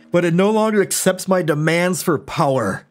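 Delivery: ordinary delivery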